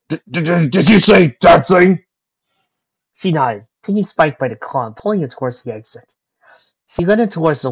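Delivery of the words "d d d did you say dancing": stammering surprise. Acted. Stammering in shock at dancing.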